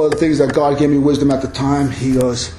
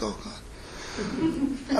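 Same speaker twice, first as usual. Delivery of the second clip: muttered dismay; 6 semitones higher, 16 dB quieter than usual. Retold or imitated. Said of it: "I'm like".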